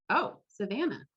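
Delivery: ordinary talk, nothing marked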